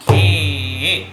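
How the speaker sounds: GHE held long and firmly; drawn-out syllable call. Shown as a demonstration. Teacher demonstrates the stroke, syllable drawn out. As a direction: call out a sustained tabla syllable. Calls syllable for pupils to repeat.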